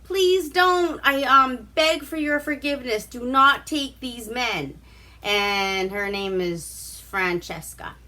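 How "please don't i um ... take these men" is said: high, frantic begging, imitated; pleading. Redhead begs the prince to spare the men, high pleading. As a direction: play a redhead begging for the men's lives. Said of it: "she's just like".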